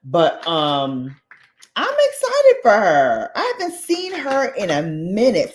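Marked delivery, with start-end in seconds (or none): none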